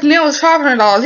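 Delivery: spring the price on him; smug demand. Retold or imitated. Girl raising the price on the player, sassy. Haggling quarrel.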